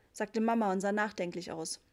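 usual speaking voice

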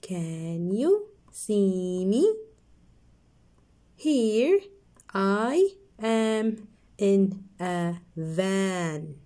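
slow, drawn-out reading. Shown as a demonstration. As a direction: stretch each word for kids. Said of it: "One more time".

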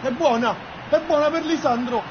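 breathless italian goal commentary. Acted. Goal scored in the game.

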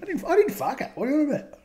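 very high squeaky protest; squeaky mock cheater. Co-host's mock cheater squealing his denial, very high. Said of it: "Oh, that is true, Brycey".

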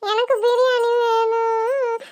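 very slow whining plea; drawn-out wail. Acted. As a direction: stretch it out like a stubborn crying child. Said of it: "எதுக்கு அழுகுற?".

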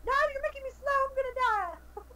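high-pitched complaint under threat; worked-up gamer whine; panicked protest. Real, not acted. Protests while about to lose.

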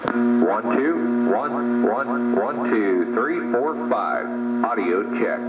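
ordinary talk

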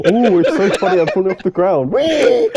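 loud giddy squeals; overjoyed and silly. Real, not acted. Laughing fit while playing.

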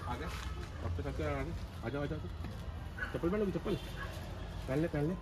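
soft coaxing. Real, not acted. Parent gently wakes sick child.

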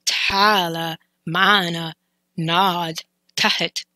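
"Ta'ala ma'ana nod tahet" slowly syllable by syllable; slow careful Arabic. Shown as a demonstration. Teacher slowly breaking down a mouthful phrase. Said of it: "A mouthful".

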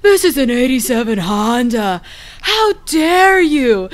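outraged dim-witted dude; hammed-up indignant doofus; dumb guy voice. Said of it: "Dax Shepard's character in that stupid movie".

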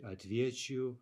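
отвечу stressed on ве; stem stress. Shown as a demonstration. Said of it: "stay where it is on the stem".

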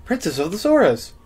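princess voice. Pretend. Voicing Princess Ruto.

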